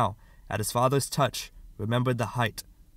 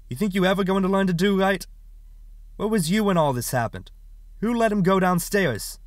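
high, loud, accusing demand; angry scolding; 8 semitones higher, 4 dB louder than usual. Acted. Father blaming mother for son's injury, angry scolding. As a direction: lay into them with raised, rapid reproach.

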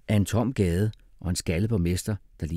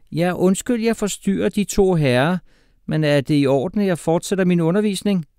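loud, high, mock-polite teacher; sarcastic teacher; 12 semitones higher, 7 dB louder than usual. A narrator's reading. Teacher interrupting two whispering pupils, loudly sarcastic. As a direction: cut in loudly with mock apology.

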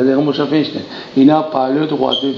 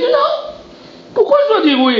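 indignant retort. Pretend. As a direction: play the offended friend refusing, hammed up. Acting out the café quarrel.

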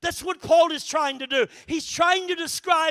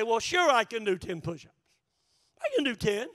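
casual; 5 semitones lower, 4 dB quieter than usual. Retold or imitated. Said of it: "And I just casually say".

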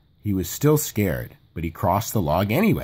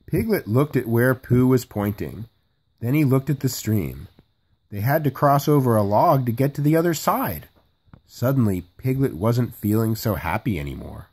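usual speaking voice

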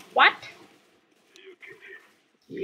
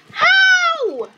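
high, long-drawn wail of disbelief; incredulous high yell; exasperated wail; 12 semitones higher than usual. Real, not acted. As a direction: whine it out slowly at the game. Disbelief at dying again.

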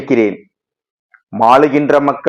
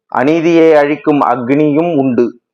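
usual speaking voice

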